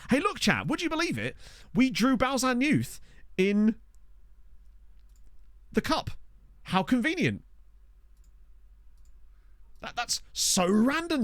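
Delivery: high-pitched mock disbelief; sarcastic amazement. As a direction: play up fake wonder to the viewers. Mock amazement at suspicious cup draw.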